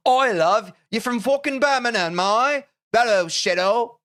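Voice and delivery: loud, high Birmingham accent put on for chat; mock Birmingham accent. Streamer showing off a Birmingham accent, loud and high. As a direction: bellow it like a cheeky Brummie. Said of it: "that was kinda good".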